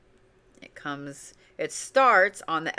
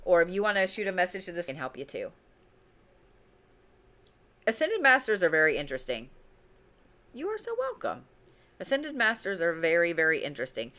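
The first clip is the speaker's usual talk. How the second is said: usual speaking voice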